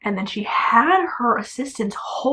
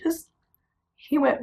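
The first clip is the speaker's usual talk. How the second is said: ordinary talk, nothing marked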